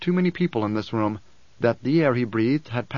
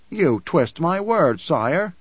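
protesting; 5 semitones higher, 4 dB louder, much slower than usual. Acted. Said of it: "Edric protested".

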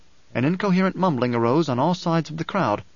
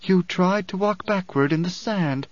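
slow, higher, solemn pronouncement; intoning; 5 semitones higher, slower than usual. A narrator's reading. Alia answers the grieving mother, intoning. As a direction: intone it slowly as a riddle. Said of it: "Alia intoned".

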